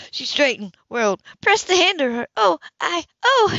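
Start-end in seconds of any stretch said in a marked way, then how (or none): 2.4-3.6 high, loud flustered stammer; breathless startled stammer; startled gasp. Acted. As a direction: caught off guard, the words falling apart. Grace startled by Ethan.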